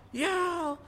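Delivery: put-on short, very high kid's yelp; imitated child yelling. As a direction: mimic a boy yelling back.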